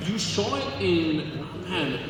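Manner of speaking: ordinary talk, nothing marked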